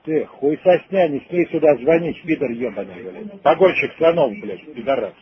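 ordinary talk, nothing marked

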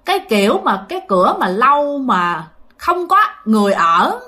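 ordinary delivery

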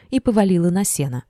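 usual speaking voice

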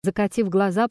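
ordinary delivery

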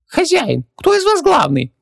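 very high squeaked voice; piping little creature; squeaky genie. A narrator's reading. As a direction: squeak it like a tiny djinn. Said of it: "пропищал Хубаксис".

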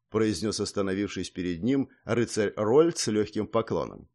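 usual speaking voice

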